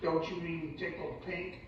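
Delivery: ordinary delivery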